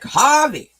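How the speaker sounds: high, loud mock call of Harvey; wife yelling name. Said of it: "she's, like, yelling his name".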